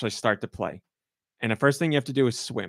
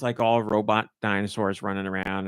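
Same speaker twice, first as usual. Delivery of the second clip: normal talking voice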